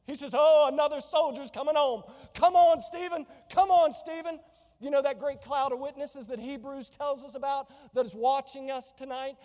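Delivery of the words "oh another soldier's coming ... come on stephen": joyful welcome. Pretend. Jesus welcoming Stephen home.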